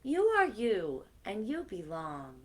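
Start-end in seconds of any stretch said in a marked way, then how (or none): none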